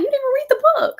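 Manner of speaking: high, loud incredulous quote; mock disbelief. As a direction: squeal it out in disbelief. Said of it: "I'm like".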